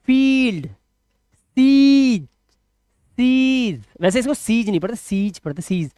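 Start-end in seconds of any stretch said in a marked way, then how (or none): 0.1-0.7 फील्ड with ee held high, "feeeld"; long ee. Shown as a demonstration. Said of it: "sound लंबा जाएगा". 0.7-3.8 सीज with a long held ee. Shown as a demonstration. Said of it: "sound लंबा जाएगा".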